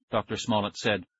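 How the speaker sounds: usual speaking voice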